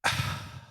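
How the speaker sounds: a real, breathy sigh of distress; shaky sigh. Real, not acted. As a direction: let out an upset sigh, barely voiced. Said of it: "it was upsetting".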